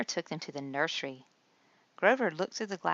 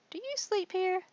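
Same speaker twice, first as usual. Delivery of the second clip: put-on much higher, curious childlike puppet voice; high-pitched puzzled question; Ernie's voice. A narrator's reading. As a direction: ask it like a wide-eyed little Ernie. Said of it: "Ernie asked the doctor".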